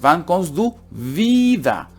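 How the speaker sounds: slow "wieder" with a high, drawn-out ie; long I. Shown as a demonstration. Teacher modelling the question for learners, long ie in "wieder". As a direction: stretch the ie in "wieder".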